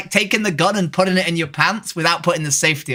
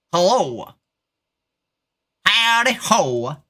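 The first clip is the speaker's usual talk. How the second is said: put-on Texas accent; slower than usual. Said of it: "You want me to do a Texas accent?".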